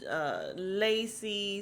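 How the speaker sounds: ordinary delivery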